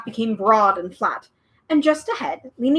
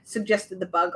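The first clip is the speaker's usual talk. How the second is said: ordinary delivery, nothing marked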